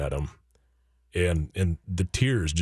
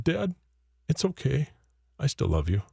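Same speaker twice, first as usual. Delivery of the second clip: put-on child's tearful voice; 7 semitones higher than usual. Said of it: "tears still coming down... blubbering".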